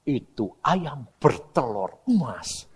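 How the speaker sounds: slow, measured voice of God; solemn imitation of God; God speaking. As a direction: spell it out very slowly as a divine message. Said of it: "Tuhan ini ngomong".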